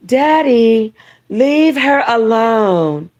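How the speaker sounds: slow, high pleading daughter; Persia voice. Acted. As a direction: beg daddy slowly in her voice. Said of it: "what about Persia".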